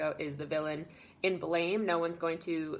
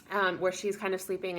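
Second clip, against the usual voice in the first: normal talking voice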